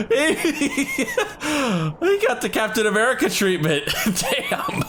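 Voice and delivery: laughing hard. Real, not acted. Streamer cracks up at game.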